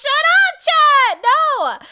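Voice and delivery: high, loud mock-indignant shout; squeaky, loud protest at the viewers; yelling at chat. Real, not acted. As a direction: shout down the chat, playfully offended. Yelling at chat after being called washed.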